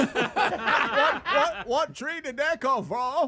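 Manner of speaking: put-on botched accent like Forrest Gump. Host asking about huge Christmas balls, botched Gump-like accent. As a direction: attempt British and land on slow Southern simpleton. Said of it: "It's Forrest Gump".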